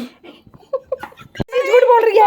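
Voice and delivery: slow, high, giggling words; amused protest through laughter; laughing accusation. Real, not acted. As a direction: laugh while calling out the lie. Accusing sister while laughing.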